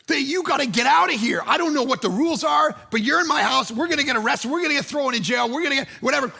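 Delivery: high, urgent, worked-up rant; panicked urgency. Retold or imitated. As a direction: act out frantic pleading to clear out. Retold panic about being arrested.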